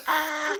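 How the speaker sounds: strangled high little squeak; a small choked squeak; tiny squeak. Shown as a demonstration. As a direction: show how no scream comes out. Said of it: "no sound's coming out of my mouth".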